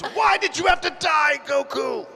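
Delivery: high, loud pleading cry; anguished shout. Retold or imitated. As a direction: cry it out in grief. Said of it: "It was".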